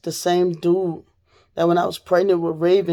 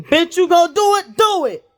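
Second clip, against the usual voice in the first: high, loud dare shouted at the attackers; defiant shout; 11 semitones higher, 4 dB louder than usual. Retold or imitated. Daring the attackers who tied her up, a high loud shout. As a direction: yell it as a reckless challenge to the men.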